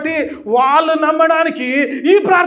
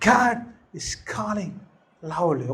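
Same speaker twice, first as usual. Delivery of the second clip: much softer, slow murmured line; softly; 7 semitones lower, 9 dB quieter, much slower than usual. Shown as a demonstration. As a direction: let it drift down as if to yourself. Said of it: "మెల్లగా".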